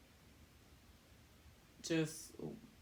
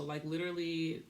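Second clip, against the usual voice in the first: normal talking voice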